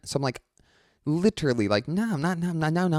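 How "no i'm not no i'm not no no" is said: higher, fast "no"s brushing him off; exasperated refusal. Retold or imitated. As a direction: fend him off, getting flustered. Refusing the pushy fan.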